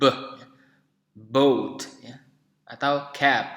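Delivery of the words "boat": "boat" with a voiced b, set apart; English b demo. Shown as a demonstration. Teacher giving an example of b, careful English "boat". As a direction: model the word "boat" carefully. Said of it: "dengan bunyi b".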